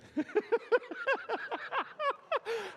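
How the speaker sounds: high burst of laughter; big laugh. Real, not acted. Laughing after she tells on herself. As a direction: laugh out at the slip. Crowd laughs at her slip.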